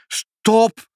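high, loud plea to halt; panting cry. A narrator's reading. Pinkepank begging mother to halt the cats, high loud cry. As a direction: burst it out high and desperate. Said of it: "riep meneer Pinkepank hijgend".

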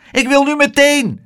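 put-on high, loud insistent boy; the boy insisting on going now; demanding child. A narrator's reading. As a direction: demand it like a stubborn little boy.

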